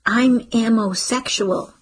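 slow, lisping with fake teeth; lisped. A narrator's reading. Girl lisping into her mother's ear through fake teeth, slow. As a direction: lisp it slowly into her ear. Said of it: "she lisped in her ear".

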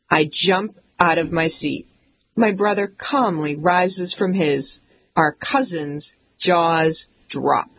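plain reading voice

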